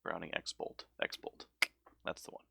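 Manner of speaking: quiet recall, ending in a whisper; muttered to self. Real, not acted. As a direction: talk to yourself as it comes back. Remembers the name under his breath.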